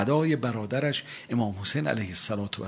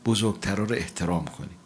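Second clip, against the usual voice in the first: usual speaking voice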